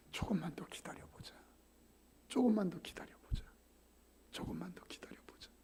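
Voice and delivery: whispered, tender waiting; mother whispering to wait. Retold or imitated.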